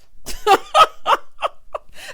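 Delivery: loud squealing burst of laughter; high shrieking laugh. Real, not acted. Vlogger laughing at the fake apology, high shriek.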